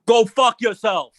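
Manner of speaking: loud, slow, punched-out curse; blunt, forceful insult. Shown as a demonstration. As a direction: say it forcefully, every word hit hard. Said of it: "Look at the difference".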